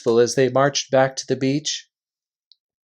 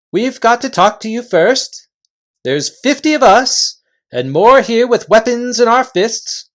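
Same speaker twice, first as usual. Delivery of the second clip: loud, high chieftain calling a halt; gruff invisible chief; 8 semitones higher, 5 dB louder than usual. Acted. Invisible chief halting the Narnians, loud and high. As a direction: bark the warning from thin air.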